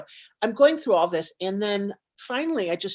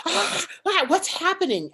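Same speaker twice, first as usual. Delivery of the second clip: fight or flight squeal; 9 semitones higher than usual. Retold or imitated. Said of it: "it was like".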